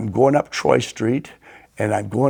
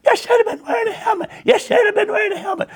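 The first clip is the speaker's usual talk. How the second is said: put-on scolding old ladies; faster than usual. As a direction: squawk it like a frantic old lady telling off a kid. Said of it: "two old ladies jump out of the truck going".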